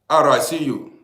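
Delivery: ordinary talk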